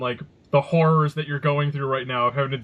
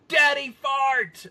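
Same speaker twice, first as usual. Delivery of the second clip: put-on kid's call-out; 8 semitones higher, much slower than usual. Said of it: "Parker will immediately go".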